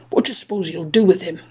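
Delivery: usual speaking voice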